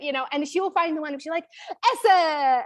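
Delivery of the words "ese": a hint of the delighted daughter, higher and louder; excited toddler. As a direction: lightly voice the daughter finding the right clothes. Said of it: "she's gonna go so excited".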